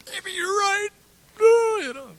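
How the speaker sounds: high, loud, hammed-up tearful wail; mock crying. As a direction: imitate yourself sobbing, overdone. Retells breaking down in counseling.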